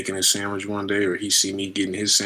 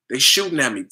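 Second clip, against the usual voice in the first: ordinary delivery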